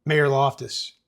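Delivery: usual speaking voice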